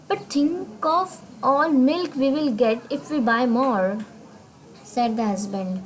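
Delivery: slow, careful reading aloud to learners; deliberate classroom read-aloud; slow story reading. Acted. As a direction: read each English word out slowly for the class. Teacher reads story text slowly.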